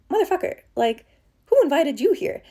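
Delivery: loud, high retold snap at the thought; indignant outburst. Speaker scolding her own doubting thought, indignant and loud. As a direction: tell off the unwelcome voice in your head.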